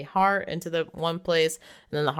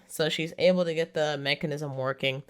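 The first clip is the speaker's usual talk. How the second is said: ordinary talk, nothing marked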